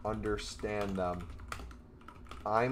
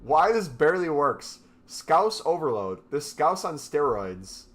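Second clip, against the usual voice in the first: excited; 4 semitones higher, 7 dB louder than usual. Real, not acted. Reacting excitedly to AI output.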